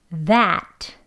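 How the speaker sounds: "that" with tongue between teeth and a drawn-out open a; long a in that. Shown as a demonstration. Teacher models a long open-vowel "that". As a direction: stretch the vowel in "that". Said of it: "소리를 조금 길게 합니다".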